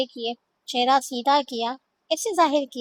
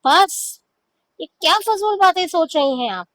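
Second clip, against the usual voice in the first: exasperated; 5 semitones higher, 5 dB louder than usual. Acted. Husband exasperated by accusation.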